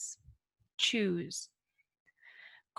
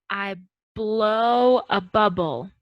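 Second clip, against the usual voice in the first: slow example; 3 dB louder, slower than usual. Shown as a demonstration. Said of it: "For example".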